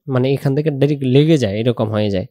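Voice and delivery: ordinary talk, nothing marked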